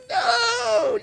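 very high, drawn-out mock wail; imitated wailing. Husband imitating his wife learning the puppy died, high drawn-out wail. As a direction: imitate a long, keening cry of grief. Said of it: "my wife starts wailing".

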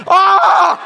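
loud, high-pitched comic yell of alarm; mock scream on being jolted awake; startled scream. Man licked awake by his dog, screaming in comic alarm. Acting out waking to dog's licking.

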